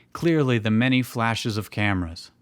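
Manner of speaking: ordinary talk, nothing marked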